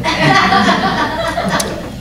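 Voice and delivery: a high, light laugh that falls away. Real, not acted. Laughing at her own flippant French. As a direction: laugh it off, amused at yourself.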